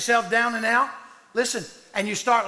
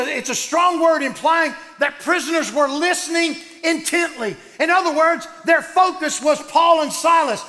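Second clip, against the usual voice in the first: loud, driving preacher's cadence; full-force preaching; 4 semitones higher, 4 dB louder than usual. Acted. Preacher rousing his congregation, loud and driving. Preaching about Paul and Silas.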